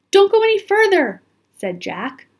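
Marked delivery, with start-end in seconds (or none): 0.1-1.2 high, quick, loud warning; urgent warning. A narrator's reading. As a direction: stop her nervously before she goes on. Jack warns Annie in tomb.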